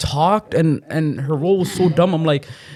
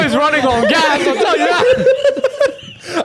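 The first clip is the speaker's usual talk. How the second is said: high, drawn out through real laughter; laughing hard; 8 semitones higher, slower than usual. Real, not acted. Joking to friends that Superman runs on gas, laughing hard. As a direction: crack up while getting the joke out. Cracking up at own gas joke.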